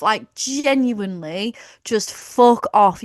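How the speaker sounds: slow, with fuck punched hard; emphatic fed-up dismissal, fuck stressed; drawn-out exasperation. Real, not acted. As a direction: spell out the annoyance slowly. Exasperated rant at wrestlers.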